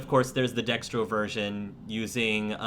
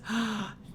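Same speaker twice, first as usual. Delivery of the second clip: delighted gasp; 11 semitones higher, 5 dB quieter than usual. Acted. Girl delighted by hat.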